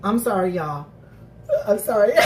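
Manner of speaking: usual speaking voice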